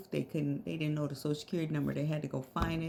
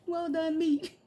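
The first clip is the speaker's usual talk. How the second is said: laughing; 10 semitones higher, 4 dB louder, slower than usual. Real, not acted. Laughing at own joke.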